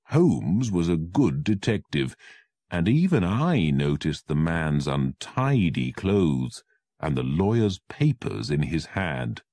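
plain reading voice

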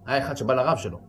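ordinary talk

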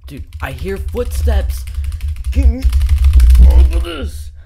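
frantic as the footsteps close in; panicked. Real, not acted. Player hearing the killer's footsteps, panicked shouting.

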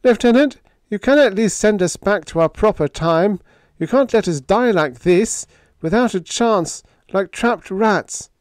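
high, thin, frightened pleading; scared bleating yammer. Acted. Hallowell begging the lieutenant to send them back, bleating in fright. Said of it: "his voice was a thin, bleating yammer".

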